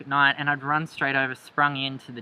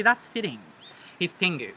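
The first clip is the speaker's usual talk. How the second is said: ordinary talk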